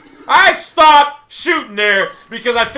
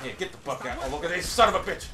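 ordinary talk, nothing marked

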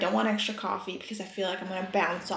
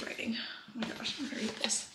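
touched whisper; 11 dB quieter, faster than usual. Real, not acted. Reading a long, heartfelt card.

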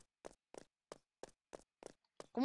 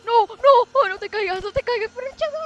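panicked; 8 semitones higher, 12 dB louder than usual. Real, not acted. Panics as character falls.

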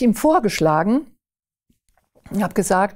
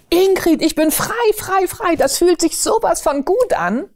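much higher, overjoyed cry of relief; joyful exclamation; 10 semitones higher than usual. Retold or imitated. Paul on phone to Ingrid, overjoyed high cry of freedom. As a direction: ring out freedom like a released prisoner.

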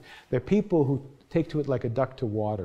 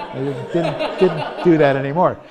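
loud real laugh, then words spilling through it; 4 semitones higher, 10 dB louder than usual. Real, not acted. Lecturer admits to students he never tried it again, laughing. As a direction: laugh it off, louder and higher. Laughs at his own retreat.

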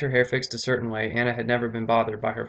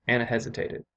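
usual speaking voice

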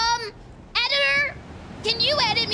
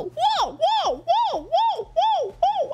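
high repeated cartoon whoas; like a broken fire alarm; Crash Bandicoot whoa; 12 semitones higher than usual. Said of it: "Now do the whoa, whoa one".

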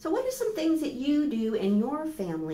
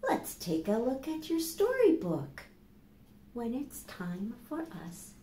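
put-on reading to children; 3 dB quieter, slower than usual. Teacher reading a bedtime book to children, gently. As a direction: lull them with a soft storybook voice.

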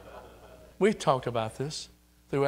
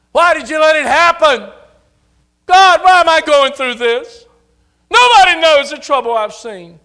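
high, loud whining cry to God; mock wail, much higher and louder; mock wailing complaint; 13 semitones higher, 17 dB louder than usual. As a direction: ham up the self-pitying why. Mocking complaining prayer.

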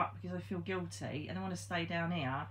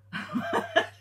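breathy laugh; 6 dB louder than usual. Real, not acted. Laughing after insulting stepfather.

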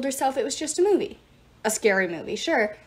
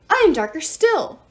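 loud, higher snarl; snarled boast; 6 semitones higher, 7 dB louder than usual. A narrator's reading. Jairus outdoing a sibling in darkness, snarled boast. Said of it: "snarled Jairus".

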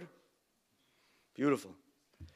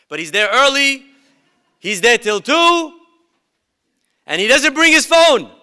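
higher and louder, building to a peak; building excitement; 9 semitones higher, 12 dB louder than usual. A narrator's reading. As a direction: pile it up, each line bigger. Narrator building excitement about obedience.

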